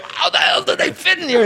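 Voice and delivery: very high incredulous voice; squeaky amazed tone; mock puzzled fan. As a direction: wonder aloud in a squeaky voice. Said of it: "people are going".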